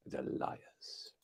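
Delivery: conspiratorial whisper; whispered aside. Pretend. Streamer confiding to chat that doubters are liars, whispered. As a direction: lean in and whisper it to the viewers. Aside to viewers about fleeces.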